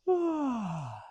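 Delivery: open-mouthed yawn sigh; falling yawn sigh; demo yawn. Shown as a demonstration. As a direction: yawn aloud as an example. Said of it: "as you're yawning".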